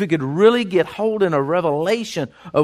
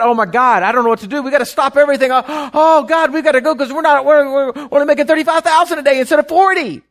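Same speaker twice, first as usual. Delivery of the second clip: higher, louder hammed-up frantic panic; psyched-out panic; 8 semitones higher, 6 dB louder than usual. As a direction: play a rich man losing his mind over nothing. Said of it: "get you all, all psyched out".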